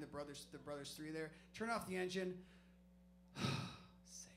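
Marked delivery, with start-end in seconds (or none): 3.3-4.3 relieved breath. Retold or imitated. Thinks he escaped the cop.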